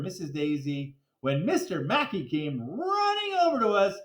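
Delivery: usual speaking voice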